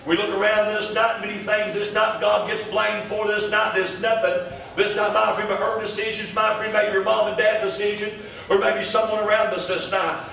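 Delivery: rhythmic sermon cadence with repeated my friend; preaching. Real, not acted. Pastor addressing the congregation, steady preaching cadence. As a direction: preach it to the room, phrase by phrase.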